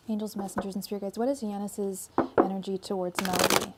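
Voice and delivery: steady, even chant of the question; tarot invocation. Acted. Tarot reader repeats her question to the spirit guides, lightly chanted. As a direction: call on the spirit guides like a ritual.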